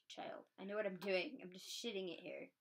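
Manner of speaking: ordinary delivery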